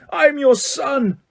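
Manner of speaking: very high, slow pleading wail; drawn-out anguish. A narrator's reading. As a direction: stretch it out in despair. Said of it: "he screamed up at Crouch".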